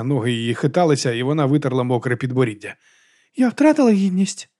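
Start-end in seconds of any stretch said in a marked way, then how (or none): 3.4-4.5 high-pitched worried asking; lifted, plaintive question; tipsy question. A narrator's reading. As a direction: ask it high, with drunken concern. Drunk heroine asks after being sick.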